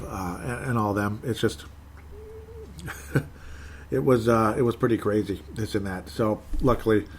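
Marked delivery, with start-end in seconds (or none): 2.1-3.9 high laugh. Real, not acted. Cracking up mid-sentence.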